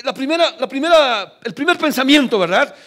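loud, high insistence; emphatic preaching. Real, not acted. Pastor stressing the first thought, loud and high. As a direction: press the point hard.

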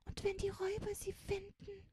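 very high, thin-voiced worry; hushed, high plaintive question; anxious wailing. A narrator's reading. Child fears for Mimutz among the robbers, high anxious wail. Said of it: "jammerte Fingerspitzchen".

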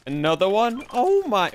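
shrieking jackpot winner. Real, not acted. Second rare gun found.

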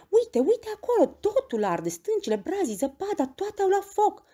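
very high, loud urgent cries; breathless-with-wonder child calling Peter to look; excited alarm. A narrator's reading. Heidi thinks mountains are on fire.

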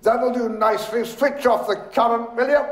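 high, quick call; brisk cry. A narrator's reading. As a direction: rattle off the order high and briskly. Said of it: "he cried briskly".

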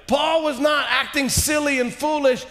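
usual speaking voice

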